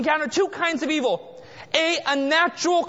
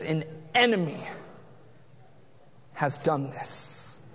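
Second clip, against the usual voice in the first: hushed and low; 11 semitones lower, 7 dB quieter than usual. Acted. Preacher voicing Jesus to the congregation, hushed and low. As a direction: drop the voice low and slow for Jesus' words.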